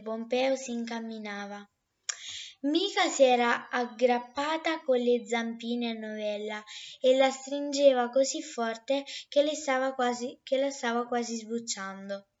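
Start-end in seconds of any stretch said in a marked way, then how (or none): none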